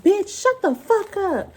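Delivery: high, slow mock yell at the old lady; retold yell. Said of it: "I was like".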